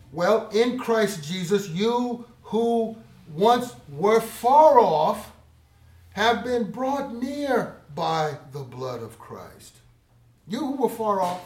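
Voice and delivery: loud, slow, ringing scripture read; booming pulpit delivery; preaching at full force. Acted. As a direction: proclaim each word slowly to the crowd.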